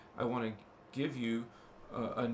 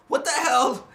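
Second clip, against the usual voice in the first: loud, much higher startled exclamation; surprised outburst; 12 semitones higher, 12 dB louder than usual. Real, not acted. Host seeing the view count, loud surprised burst. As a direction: blurt it out, amazed at the numbers.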